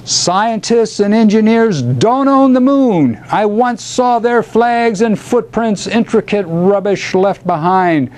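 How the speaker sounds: high, incantatory declamation; bellowed invocation. A narrator's reading. Poet calling the great spirits to the moon, bellowed. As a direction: declaim it skyward like a bard summoning spirits. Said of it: "he bellowed into the".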